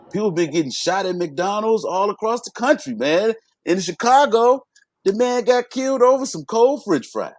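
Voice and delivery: rising heated rant. Real, not acted. Outraged rant about McDonald's shooting.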